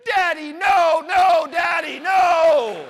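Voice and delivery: put-on loud, high, full-throated child's scream of protest. Little girl screaming at dad in men's bathroom, loud scream.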